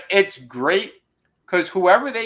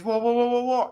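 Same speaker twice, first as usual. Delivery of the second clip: high mock panicked whoa; 11 semitones higher than usual.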